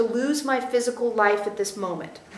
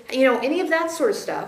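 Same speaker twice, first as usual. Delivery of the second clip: normal talking voice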